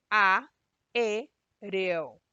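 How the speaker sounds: a, e, reo: each syllable said alone with a pause, the first one hit hard; syllables split apart. Shown as a demonstration. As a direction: break aéreo into its syllables. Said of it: "Lo mismo en aéreo". (separar a, e, reo sílaba por sílaba)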